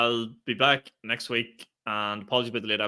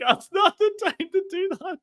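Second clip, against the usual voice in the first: high, put-on voice, pitched way up; mock exasperated heckle. Comic recalling his shock at a comedian sipping water in silence. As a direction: squeak out a disbelieving telling-off. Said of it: "and I was like".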